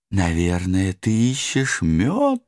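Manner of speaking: high, slow bouncy cartoon tiger; excited Tigger voice. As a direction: whoop it out like Tigger. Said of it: "продолжала надрываться великолепная вамп". (восторженный голосок Тигры)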